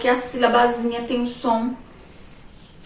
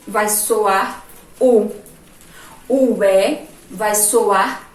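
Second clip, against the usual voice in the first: vai soar U said slowly, stretching out the U; slow, deliberate teacher explanation; slow emphasis; 4 dB louder, much slower than usual. Shown as a demonstration. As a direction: spell out slowly, word by word. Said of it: "ou seja, fraca".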